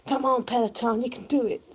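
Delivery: high-pitched mock cheerleading; pretend Peloton coach. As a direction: egg them on like a bike instructor. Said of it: "pretend to each other that we're".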